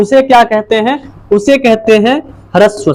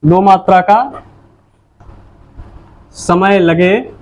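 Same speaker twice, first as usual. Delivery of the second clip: slow dictation; 4 semitones lower, slower than usual. Shown as a demonstration. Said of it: "क्या लगे?".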